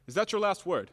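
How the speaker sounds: loud, pointed demand; loud challenge. Acted. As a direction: throw the question down like a gauntlet. Confrontation over unpaid debt.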